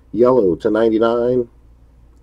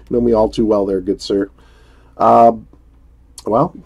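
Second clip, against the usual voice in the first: usual speaking voice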